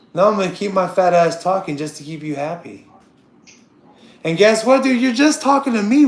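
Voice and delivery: normal talking voice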